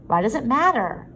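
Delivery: heated, defensive question; rising temper. Acted. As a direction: push back with rising irritation. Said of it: "beginning to lose his temper".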